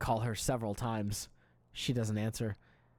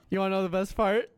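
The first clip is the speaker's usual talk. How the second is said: asked through laughter, higher and louder; a giggly tease; grinning setup; laughing; 8 semitones higher, 7 dB louder than usual. Real, not acted. Teasing listener mid-laugh.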